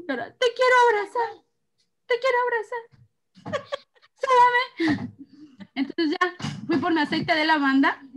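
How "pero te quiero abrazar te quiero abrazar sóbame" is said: put-on higher childish plea; pleading child. Son begging mom for a hug, childish plea. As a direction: beg like a little boy for a hug.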